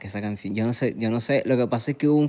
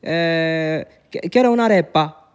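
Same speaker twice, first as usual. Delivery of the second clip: sing-song mock Italian accent; Italian-accented Spanish; 3 semitones higher, slower than usual. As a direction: imitate an Italian ordering in Spanish. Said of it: "con un acento".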